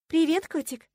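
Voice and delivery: sugary purr, pitched high. A narrator's reading. Fiancée greeting Marcus with fake joy, purring. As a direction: coo it loudly at a sweetheart. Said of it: "промурлыкала я".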